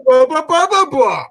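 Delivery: high, loud mocking gibberish; mocking blah blah. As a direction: parrot them back in a teasing yap. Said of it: "he's imitating".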